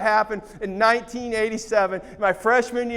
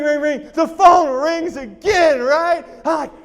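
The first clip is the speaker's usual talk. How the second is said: usual speaking voice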